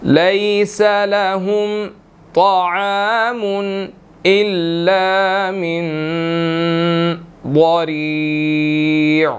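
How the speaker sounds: slow, drawn-out tajweed recitation; slow Quranic recitation. Shown as a demonstration. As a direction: chant each word slowly. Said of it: "teacher recites the verse".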